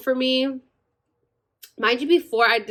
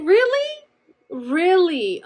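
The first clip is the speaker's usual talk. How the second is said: indignant disbelief; 9 semitones higher, slower than usual. Retold or imitated. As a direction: sell the betrayal in two takes. Mom skipped the promised Starbucks.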